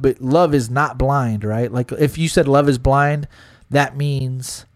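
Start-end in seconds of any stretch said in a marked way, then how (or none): none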